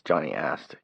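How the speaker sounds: ordinary delivery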